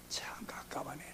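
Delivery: breathless sigh under the breath; frustrated aside. Real, not acted. Pastor sighing to himself about church divisions, softly. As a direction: let it slip out as a soft, exasperated aside.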